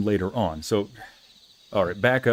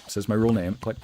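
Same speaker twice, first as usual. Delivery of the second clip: normal talking voice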